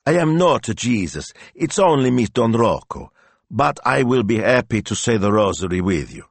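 high, emphatic protest; flustered priest. A narrator's reading. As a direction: correct her in embarrassment. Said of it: "Don Rocco's face burned red".

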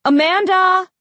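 loud, high name-call; calling after. A narrator's reading. Mom calling after daughter on the stairs, loud high shout. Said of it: "I heard Mom call after me".

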